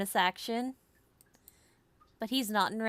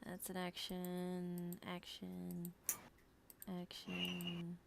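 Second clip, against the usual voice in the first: soft, slow murmur while reading; muttering to self; 3 semitones lower, 17 dB quieter, slower than usual. Real, not acted. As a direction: mumble it half to yourself. Checking spells, muttering to self.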